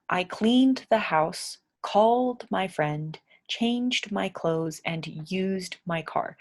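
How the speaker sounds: "cleaned", "called", "changed", "used" said slowly, each final d clear; slow drill sentence, stressing each d ending. Shown as a demonstration. Teacher to learners, slowly drilling d endings. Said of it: "all of these end in a d sound".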